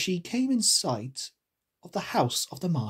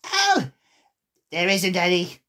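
put-on creature voice, starting high; high, loud 'oh', then settling; brash storybook animal; March Hare; 3 semitones higher, 4 dB louder than usual. A narrator's reading. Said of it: "said the March Hare".

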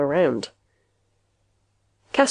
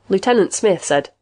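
usual speaking voice

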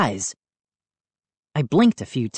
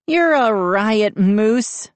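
sarcastic declaration; 6 semitones higher, slower than usual. A narrator's reading. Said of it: "Mindy declared".

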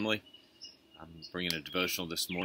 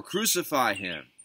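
higher, slow, insistent crowd cry; narrator's raised-voice crowd shout; mild shout; 5 semitones higher, 7 dB louder, slower than usual. A narrator's reading. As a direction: call it out slowly with raised pitch. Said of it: "they shouted all the more".

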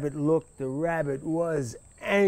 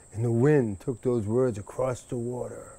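ordinary delivery

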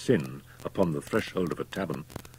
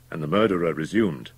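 usual speaking voice

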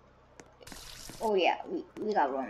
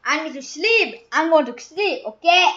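higher, louder whiny insisting; high loud whiny kid; insistent; 5 semitones higher, 9 dB louder than usual. Pretend. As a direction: demand your sleep. Insisting on going to sleep.

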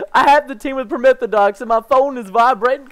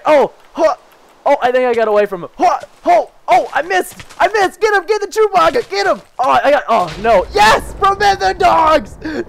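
frantic cheering; 6 semitones higher than usual. Real, not acted. Frantic fight, cheering teammate.

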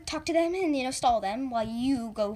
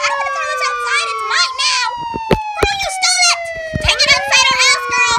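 screaming quarrel; 12 semitones higher, 9 dB louder than usual. Acted. Sisters quarrel.

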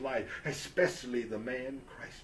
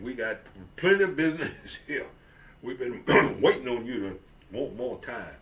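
louder, quick boss with a laugh; jovial boss; 8 dB louder, faster than usual. Acted. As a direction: boom it out like a pleased employer. Boss voiced in story.

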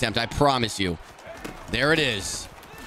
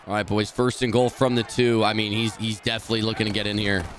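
usual speaking voice

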